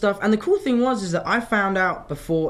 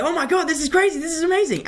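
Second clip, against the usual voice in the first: high-pitched, overjoyed retelling; imitating his own giddy joy in bed; thrilled squeal; 13 semitones higher than usual. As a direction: squeal like you just got the news of your life. I was screaming and shouting.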